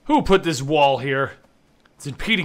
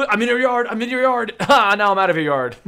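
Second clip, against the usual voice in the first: high-pitched, gleeful taunting; playful taunt; 6 semitones higher, 4 dB louder than usual. Pretend. Player bragging about trespassing in a yard, gleeful taunt. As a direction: tease like a kid getting away with it.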